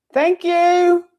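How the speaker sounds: teacher thanks departing assistant, loud high call. Real, not acted. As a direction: call your thanks after her.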